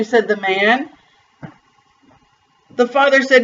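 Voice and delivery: ordinary delivery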